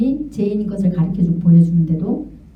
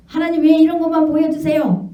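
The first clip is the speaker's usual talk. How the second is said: high, indignant protest; a hammed-up complaining wife; arguing with God; 8 semitones higher than usual. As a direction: demand to know why only the bad is shown. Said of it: "막 하나님 앞에 잘한 거 있다고 따진 거예요".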